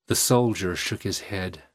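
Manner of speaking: ordinary delivery, nothing marked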